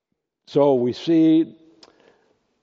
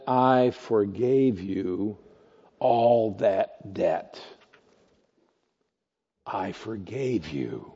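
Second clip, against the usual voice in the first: slow emphatic master; 5 semitones lower, 4 dB quieter, much slower than usual. Acted. Master's pained repetition.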